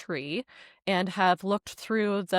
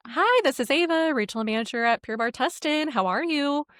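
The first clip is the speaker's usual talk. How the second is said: high-pitched manager's phone greeting; 6 semitones higher than usual. Retold or imitated. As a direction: replay a chirpy professional hello, pitched up.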